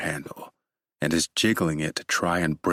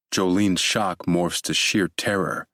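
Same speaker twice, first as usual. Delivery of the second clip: plain reading voice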